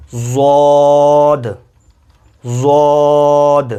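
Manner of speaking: a full, heavy زود; heavy letter demo. Shown as a demonstration. Teacher models a heavy زود for students. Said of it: "یعنی موٹا پڑھا جائے گا".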